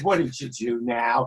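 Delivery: lightly voiced tired friend; weary friend. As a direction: sigh it like he's heard it before. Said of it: "He said".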